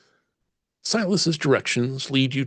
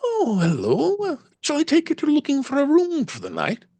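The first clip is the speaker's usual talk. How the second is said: high, light Irish lilt; gentle Irish landlady voice. Acted. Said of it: "Her voice has a slight Irish lilt".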